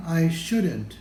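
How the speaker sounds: ordinary delivery, nothing marked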